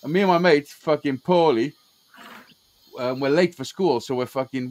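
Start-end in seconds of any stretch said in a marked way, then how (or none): none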